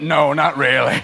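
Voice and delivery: dry dismissal. Retold or imitated. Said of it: "And I went".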